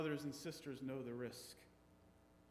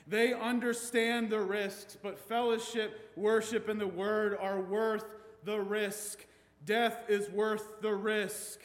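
preaching; 4 semitones higher, 7 dB louder than usual. Acted. Rising to a preaching climax.

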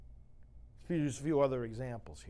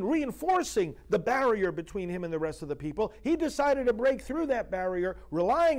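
raised, loud preaching voice; impassioned preaching; 9 semitones higher, 7 dB louder than usual. Real, not acted. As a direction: preach it out with rising fire. Preaching builds to full force.

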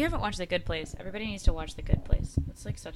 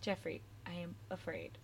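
quiet echo; 3 semitones lower, 16 dB quieter than usual. Retold or imitated. Echoing the funny email quietly.

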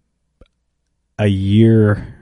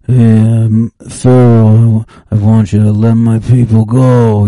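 mumbled Godfather mobster voice; slurred Godfather impression; 5 dB louder than usual. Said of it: "nobody understood The Godfather when he was like".

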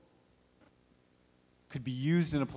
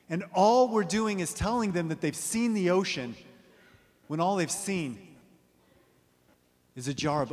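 higher and louder emphatic point; preaching at full force; 3 semitones higher, 4 dB louder than usual. Acted. Preacher presses his point on the congregation, emphatic. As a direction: drive the image home.

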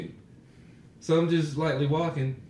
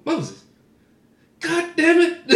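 startled outburst; 7 dB louder, slower than usual. Retold or imitated. Startled friend's exclamation retold.

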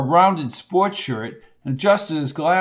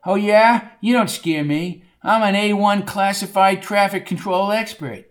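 puffed-up comeback, pitched up; defiant boasting; 4 semitones higher than usual. Acted. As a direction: brag back at a heckler. Bucky boasts back at Coco.

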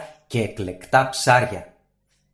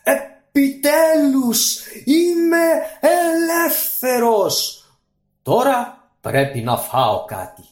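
high, loud, slow genie voice; a freed genie bellowing in triumph; booming monstrous genie, slow; roaring genie; 14 semitones higher, 4 dB louder than usual. Acted. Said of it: "βρυχήθηκε".

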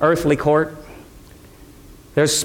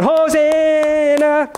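much higher and slow, stretched like a crowd call; drawn-out joyful cry; 12 semitones higher, much slower than usual. Retold or imitated. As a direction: ring out Hosanna slowly across the hill.

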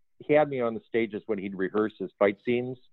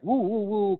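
mock kung fu cries; 11 semitones higher, slower than usual. Mocking kung fu fighting sounds.

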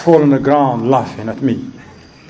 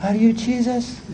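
put-on small, high, soft child's voice; little girl; 8 semitones higher, 6 dB quieter than usual. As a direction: imitate a little girl asking shyly, pitched up. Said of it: "I saw this little girl. She said".

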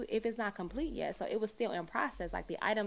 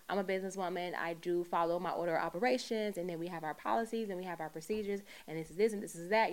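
ordinary talk

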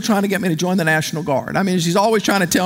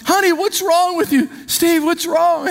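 worried mom; 9 semitones higher than usual. Retold or imitated. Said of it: "My mom goes".

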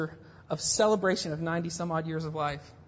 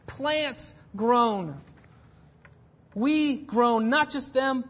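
louder, higher fervent sermon delivery; impassioned preaching; 7 semitones higher, 3 dB louder than usual. Acted. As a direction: preach it out to the congregation with force. Preaching builds to full force.

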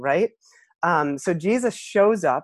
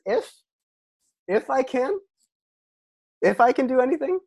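much higher, mock disbelieving echo; raised incredulous questioning tone; 9 semitones higher than usual. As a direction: repeat his words back, high and amazed. Jesus leans in, repeating.

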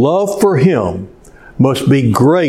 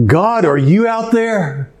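high, pleading call from the cliff; man dangling from a cliff calls up to God; desperate call to God; 8 semitones higher than usual. Retold or imitated. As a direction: cry out to God, clinging on.

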